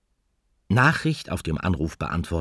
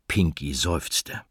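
ordinary talk

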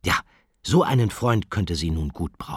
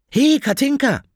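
high, loud hail across a schoolyard; calling out; 8 dB louder than usual. Acted. Benny greets Katinka in the schoolyard, calling out. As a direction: shout a bright hello to a friend far off.

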